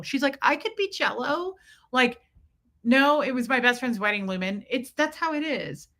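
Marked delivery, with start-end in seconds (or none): 0.4-1.6 higher-pitched, hopeful retort; lightly acted protest, pitched up; hopeful movie quote. Imitating the movie rival insisting she can change, hopeful.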